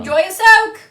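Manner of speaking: high, loud parting shout; calling out. Acted. Player leaving soldiers at hot springs, shouted farewell. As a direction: holler a send-off to bathers. Player calls goodbye to bathers.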